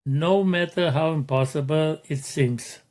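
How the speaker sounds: normal talking voice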